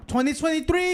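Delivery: high, loud announcing; Will J. Acted. Co-host reading a super chat, high imitated announcing voice. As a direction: put on a donor's voice and proclaim it. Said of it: "read it in his voice".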